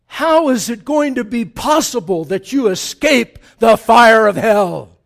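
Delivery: loud, thundering scripture rebuke; preaching at full force. Acted. Preacher voicing Jesus denouncing the scribes and Pharisees, thundering. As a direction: hurl the rebuke at the hypocrites. Reads the woes.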